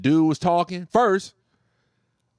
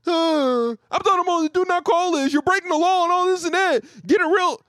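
much higher, whining mock of an angry man; mocking whiny caller; 10 semitones higher than usual. Mocking the angry caller who complained about the list, high whine. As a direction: squeal the complaint like a petulant customer. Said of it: "Then he started bitching".

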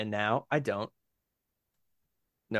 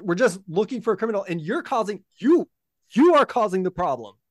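pompous militia officer; 7 semitones higher than usual. Acted. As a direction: lecture her like a self-important official. Militia leader Kelsey Grammer berates.

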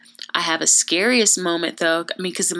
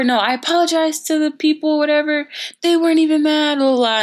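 high, louder protesting; retold excuse; 9 semitones higher, 4 dB louder than usual. Student defending herself to the principal, high protest. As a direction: argue your innocence, pitched well up. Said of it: "I tell the principal".

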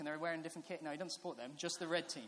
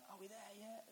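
small, high, soft pleading; soft, high-pitched child asking; little child's voice; 5 semitones higher, 10 dB quieter than usual. Retold or imitated. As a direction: imitate a kid in the back seat. Small child asking from back seat.

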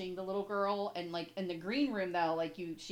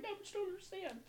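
imitating teary plea; 12 semitones higher, 7 dB quieter, faster than usual. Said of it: "I literally start crying".

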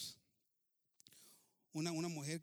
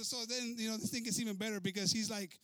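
usual speaking voice

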